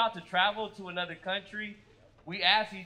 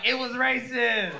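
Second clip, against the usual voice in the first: mock ally; 5 semitones higher, 5 dB louder, slower than usual. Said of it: "She just popped up like".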